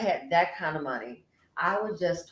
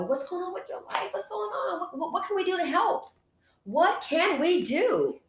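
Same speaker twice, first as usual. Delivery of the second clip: sweet high voice; 11 semitones higher, 4 dB louder than usual. Acted. Host asking viewers what's going on, cooing high. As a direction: coo the questions up high.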